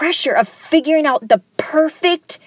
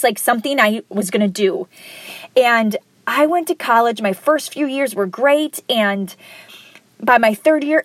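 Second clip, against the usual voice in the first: ordinary talk, nothing marked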